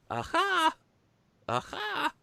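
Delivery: very high, falsetto aha; a shrill triumphant falsetto cry; squeaky aha. Pretend. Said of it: "That's the joke".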